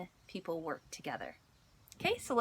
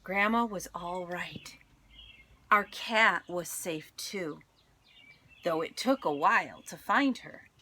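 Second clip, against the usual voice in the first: expressive read-aloud storytelling; story narration; 5 dB louder than usual. A narrator's reading. Teacher reading a picture book to her class, expressive storytelling. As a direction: tell it warmly to a class of children.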